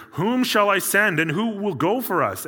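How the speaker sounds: high, booming call from the Lord. Acted. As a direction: call it out loud, high and commanding. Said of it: "the voice of the Lord saying".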